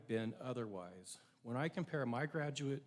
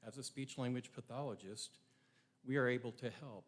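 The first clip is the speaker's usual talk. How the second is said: ordinary delivery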